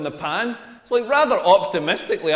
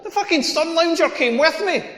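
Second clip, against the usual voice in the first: high disbelieving punchline; 9 semitones higher than usual. Real, not acted.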